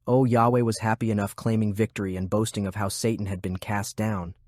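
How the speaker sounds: ordinary delivery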